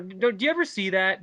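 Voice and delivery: ordinary talk, nothing marked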